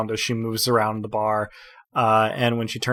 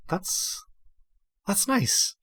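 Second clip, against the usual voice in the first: a high squeak on the first "that's", then settling down; flustered surprise; 14 semitones higher, 3 dB quieter, much slower than usual. Acted. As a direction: caught off guard, she squeaks then recovers. Kea startled at glowing.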